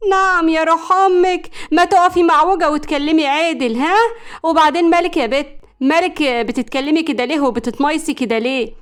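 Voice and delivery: loud, high-pitched indignant tirade; brassy scolding. A narrator's reading. Woman confronting the simpering rival over the man, indignant. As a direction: tear into her, hands on hips.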